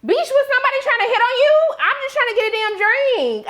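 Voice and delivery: sudden high-pitched indignation; indignant outburst. Real, not acted. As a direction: blow up at her, incredulous.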